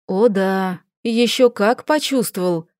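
ordinary delivery, nothing marked

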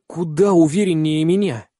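ordinary delivery

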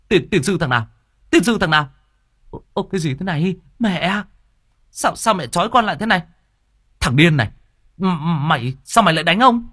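high, stammering, startled outburst; angry cursing. A narrator's reading. Son slapped awake and tied up, cursing in high-pitched anger. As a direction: curse like someone slapped awake. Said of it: "mở choàng mắt ra rồi chửi".

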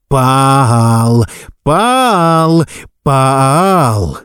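rising then falling insistent calls; repeated demand. A narrator's reading. Teacher poking the boy, calling repeatedly. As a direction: hammer the name at him. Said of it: "Костлявый палец снова и снова".

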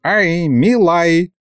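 put-on high, drawn-out old man's hail; calling out. A narrator's reading. Mikhalych hailing a staring boyar, calling out. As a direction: hail someone with stretched vowels. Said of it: "окликнул Михалыч".

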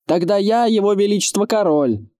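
high-pitched mocking claim; scoffing. A narrator's reading. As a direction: call it back as a scoff. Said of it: "усмехнулся лучник".

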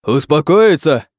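high, loud, drawn-out exclamation; outraged cry. A narrator's reading. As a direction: shriek the word back in disbelief. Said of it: "вскрикнуло существо".

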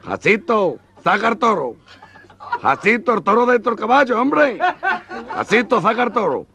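frantic orders to keep the bull away; panicked yelling. Acted. Matador yelling at Jacinto, high and frantic.

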